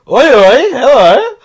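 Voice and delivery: high, loud cheeky heckle with a laugh; cheeky mate. Retold or imitated. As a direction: call out like a lad catching someone out. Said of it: "Bo's just like".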